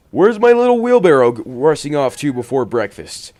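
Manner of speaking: high, loud cheery call; calling grandpa voice. Acted. Grandpa calls out.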